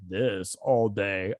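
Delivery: slow, put-on deep-ish voice. Shown as a demonstration. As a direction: demo the voice she used, slow. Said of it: "if I talk like".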